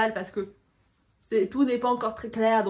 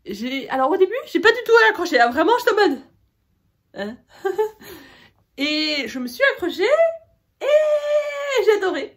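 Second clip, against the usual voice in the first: excited; 8 semitones higher, 9 dB louder than usual. Real, not acted. Enthusing about a favourite book.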